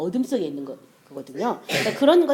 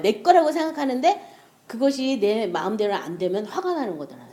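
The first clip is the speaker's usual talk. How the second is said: ordinary delivery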